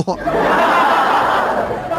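long, high wheezing laugh; real breathy belly laugh; hearty laugh. Real, not acted. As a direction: burst out laughing at your own punchline. Laughs at his own punchline.

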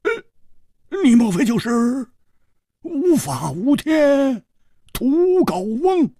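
high, strained, drawn-out realisation; hoarse. A narrator's reading. Hu Tiehua recognising the dwarf, hoarse and high. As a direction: stretch the name out slowly in dawning alarm. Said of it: "哑着声音说".

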